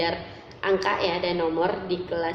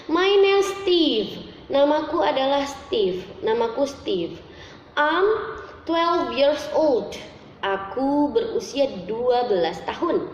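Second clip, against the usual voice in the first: model reading; 5 semitones higher than usual. Shown as a demonstration. Teacher reads textbook model sentences.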